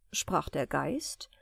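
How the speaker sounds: usual speaking voice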